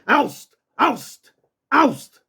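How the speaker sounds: high, sharp release order; authoritative command. Shown as a demonstration. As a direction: command the dog to drop it. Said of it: "like you a drill sergeant".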